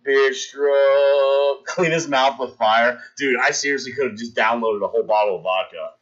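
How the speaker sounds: ordinary talk, nothing marked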